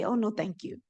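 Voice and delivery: ordinary delivery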